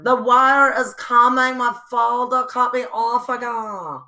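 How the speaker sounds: dramatic Anna Delvey. Retold or imitated. Mocking Anna Delvey stalling over her forty million dollar loan. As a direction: wail the excuse like a stranded heiress.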